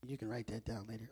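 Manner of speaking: very low, quick and soft; muttered aside. Real, not acted. Preacher tossing listeners an aside, muttered. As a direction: toss it off under your breath. Throwaway aside after his quip.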